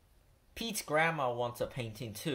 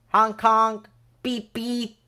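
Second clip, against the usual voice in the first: car honk noises; 9 semitones higher, 11 dB louder, slower than usual. A narrator's reading. Pete honking while driving.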